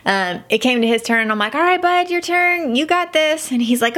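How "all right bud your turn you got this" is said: put-on higher, bright pep talk for a kid; encouraging. Mother sending her son off the zip line platform, bright and encouraging. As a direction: cheer him on, pitched up.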